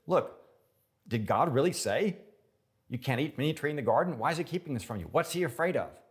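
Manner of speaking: loud, high insinuating devil; serpent's sly challenge. Retold or imitated. Serpent questioning Eve in the garden, loud and sly. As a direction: push doubt like the serpent.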